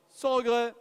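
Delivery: loud, punchy closing call; loud final auction call. Real, not acted. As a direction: bang it out like the gavel falling.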